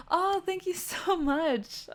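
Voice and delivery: high, drawn-out grateful gush; gushing thanks. Real, not acted. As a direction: gush your thanks to a fan, slow and high. Thanking a viewer's kind message.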